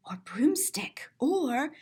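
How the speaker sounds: whispered aside; hushed, unvoiced aside. A narrator's reading. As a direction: breathe the word broomstick. Whispered aside in read-aloud.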